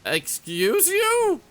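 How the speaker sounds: very high, slow, drawn-out sass; mock offended. Real, not acted. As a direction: drag it out, scandalised and sassy. Offended at a game noise.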